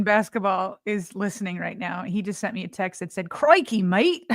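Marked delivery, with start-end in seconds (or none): 3.3-4.3 put-on light Aussie accent, slightly higher; Australian accent. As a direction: say it like a cheerful Aussie. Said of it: "Nice job on the, uh, Australian accent there too".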